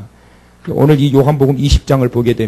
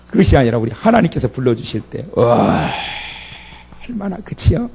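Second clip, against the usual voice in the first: ordinary talk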